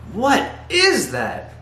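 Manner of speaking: high, drawn-out mock outrage; emotional exclamation. Shown as a demonstration. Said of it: "you're really emoting".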